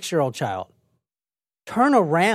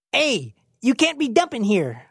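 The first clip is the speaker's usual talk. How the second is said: put-on higher-pitched, uncertain Philly accent; attempted Philadelphia accent; 6 semitones higher than usual. Co-host trying out the dumping warning, failed Philadelphia accent. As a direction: have a clumsy go at a Philadelphia accent. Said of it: "All right, I'll try it".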